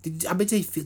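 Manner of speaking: ordinary delivery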